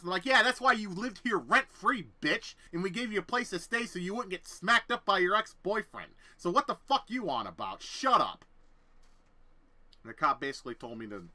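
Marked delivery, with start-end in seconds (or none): 0.2-8.4 angry retort. Retold or imitated. Telling the roommate off in front of the cop, loud. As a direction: tear into her.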